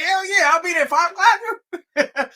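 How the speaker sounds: high-pitched, laughing imitation of a thrilled friend; overjoyed. Friend promising the prank caller to come, imitated high excitement. Retelling friend's joy at interview.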